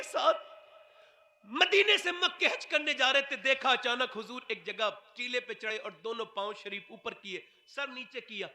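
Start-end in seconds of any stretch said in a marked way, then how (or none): 3.4-8.5 hushed storytelling. Acted. Tender retelling of the Prophet weeping.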